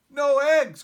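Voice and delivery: loud, high cry of dismay; cried out. A narrator's reading. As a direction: cry it out in shock. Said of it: "cried Farmer Brown".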